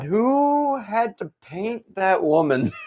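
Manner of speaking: slow, high incredulous question; amused disbelief. Real, not acted. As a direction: wonder aloud who painted her. Laughing disbelief at costume.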